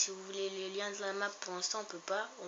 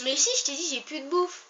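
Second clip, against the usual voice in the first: exasperated; 8 semitones higher, 10 dB louder than usual. Real, not acted. Arguing with Thomas.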